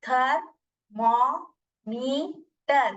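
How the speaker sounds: "थर्मोमीटर" slowly in four separate beats; slow demonstration, a gap after each syllable of "थर्मोमीटर". Shown as a demonstration. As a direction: break "थर्मोमीटर" into थर-मो-मी-टर for a chin-drop count. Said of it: "अपना चिन्ह ऐसा रख के try कीजिए".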